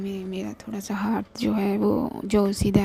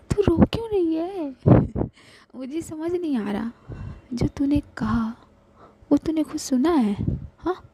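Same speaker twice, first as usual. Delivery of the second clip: higher, disbelieving and fond; bewildered friend; 7 semitones higher, 4 dB louder than usual. Acted. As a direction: ask again, amazed. Shivi bewildered. (हैरान सहेली, ऊँची आवाज़ में पूछती)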